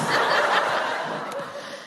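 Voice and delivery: a high unvoiced giggle; high breathy laugh. Real, not acted. Comedian giggling at her own punchline.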